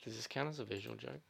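near-whispered aside; soft, breath-only murmur; hushed. Real, not acted. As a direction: keep it under your breath. Hushed while setting up visual joke.

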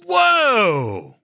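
long, high, drawn-out whoa; stunned, stretched-out exclamation; amazed whoa. Acted. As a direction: draw it out high, then let it slide down. Acting out amazed viewer's reaction.

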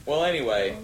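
usual speaking voice

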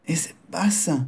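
soft whispered disappointment. Acted. Son whispers to his mother about the loss. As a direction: breathe it out, crestfallen.

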